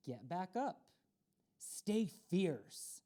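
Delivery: plain reading voice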